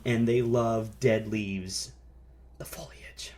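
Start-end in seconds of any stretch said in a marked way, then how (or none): 2.6-3.3 hushed whisper of the foliage. Acted. Host whispering the word foliage to his cohost. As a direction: breathe it like a secret.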